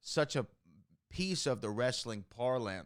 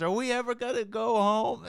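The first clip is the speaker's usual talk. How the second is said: put-on higher child whine; kid voice; 12 semitones higher, 5 dB louder than usual. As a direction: plead like a tired kid. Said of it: "this kid says".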